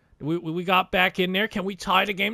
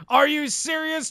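much higher, louder outraged disbelief; outraged yell; 10 semitones higher, 4 dB louder than usual. Real, not acted. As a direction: yell it at the screen in shock. Swept by Canucks.

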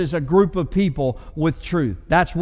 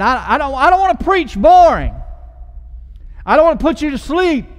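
louder and higher, driving the point home; fired-up preaching; 7 semitones higher, 6 dB louder than usual. Real, not acted. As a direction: wake the room up with it. Preacher rouses the congregation.

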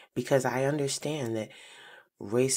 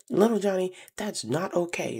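mock stern scolding; 7 semitones higher than usual. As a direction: play the stern mom telling off her son. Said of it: "his mom is going off like".

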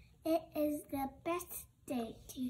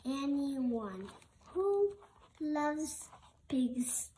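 slow, louder proud declaration; emphatic; 4 dB louder, slower than usual. Acted. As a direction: proclaim it so the elephant feels welcome. Piggy's big reassuring claim.

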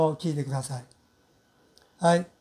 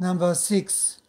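ordinary talk, nothing marked

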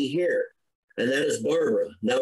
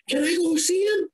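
voice breaking. Real, not acted. Asking to reach his son.

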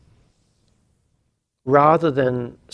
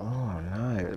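dismayed; 4 semitones lower, 9 dB quieter than usual. Retold or imitated. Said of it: "I was like".

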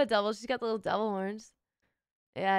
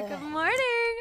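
squeaky high greeting; 12 semitones higher, 3 dB louder, slower than usual. Real, not acted.

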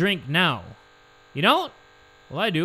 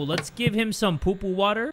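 usual speaking voice

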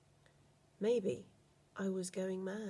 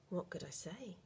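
whisper; 11 dB quieter than usual. A narrator's reading. Narrator's helpless inner thought whispered.

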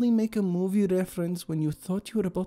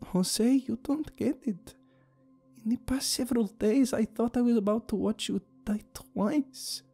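high, tearful female character voice; voice shaking. A narrator's reading. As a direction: plead on the edge of crying. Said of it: "her voice shook a little".

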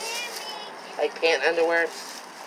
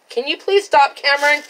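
exasperated; 7 semitones higher, 4 dB louder than usual. Real, not acted. Mom scolding son mid-video.

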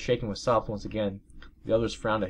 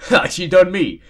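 mocking repeat; 9 semitones higher, 13 dB louder, slower than usual. Real, not acted. Mocking repeat of own line.

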